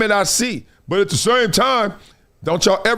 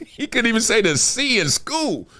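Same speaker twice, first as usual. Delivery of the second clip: ordinary talk, nothing marked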